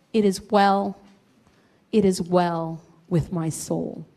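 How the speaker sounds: low, slow, reverent reading; hymn recitation. Acted. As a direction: recite it solemnly, with spaced phrases.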